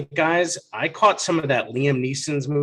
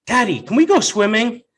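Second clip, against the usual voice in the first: put-on higher, louder little-girl ask; a daughter interrupting with a sudden question, chirpy; child's voice; 8 semitones higher, 6 dB louder than usual. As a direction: pipe up like an eager kid.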